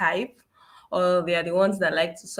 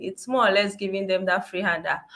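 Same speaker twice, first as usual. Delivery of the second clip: usual speaking voice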